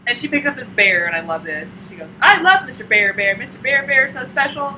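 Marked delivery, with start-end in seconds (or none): 2.2-4.8 put-on higher, louder little-girl gushing; gushing ten-year-old. Ten-year-old adoring her teddy bear, gushing. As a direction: imitate a child doting on a toy. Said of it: "what a ten-year-old says".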